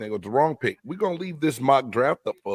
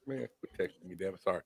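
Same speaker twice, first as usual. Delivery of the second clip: quiet aside; 5 semitones lower, 13 dB quieter than usual. Real, not acted. Aside to someone off mic.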